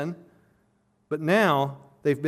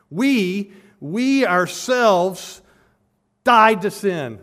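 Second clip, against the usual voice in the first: We stretched long, high and loud; emphatic stress on We; 4 semitones higher, 8 dB louder, much slower than usual. Shown as a demonstration. Preacher showing the Greek emphatic pronoun, emphatic stress on "We". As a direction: lean hard on "We" and "ourselves", slowly. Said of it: "It's emphatic".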